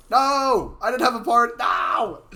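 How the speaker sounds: loud, high frustrated yelling; frustrated shout. Real, not acted. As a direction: yell out at the bad result. Match goes wrong, streamer yells.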